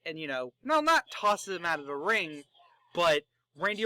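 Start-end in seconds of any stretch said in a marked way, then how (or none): none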